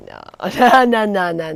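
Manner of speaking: retold no's with a laugh; laughing refusal. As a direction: laugh off the idea, shaking it away. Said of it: "I was like".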